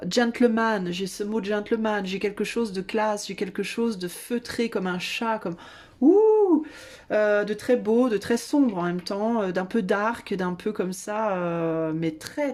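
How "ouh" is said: excited swoon. Real, not acted. Swooning over the tarot card.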